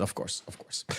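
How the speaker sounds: whispered quick aside. Real, not acted. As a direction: breathe it out under the breath.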